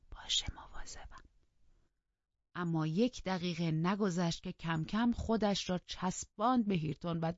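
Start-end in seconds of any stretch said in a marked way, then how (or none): 0.1-1.2 breathy whispered reply; whispered promise to the housekeeper. Acted. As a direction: answer in a hushed whisper. Catherine whispers back at table.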